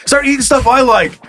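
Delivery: high-pitched griping toilet character; angry toilet. Pretend. Host playing the toilet demanding corn, high mock anger. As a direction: complain in a high mock-pissed voice. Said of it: "The toilet gets pissed".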